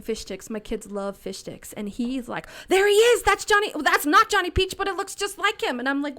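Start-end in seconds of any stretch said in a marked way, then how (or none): 2.7-5.8 put-on much higher, loud child's excitement; excited little kid. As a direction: spot it like an eager four-year-old. Voicing excited young son.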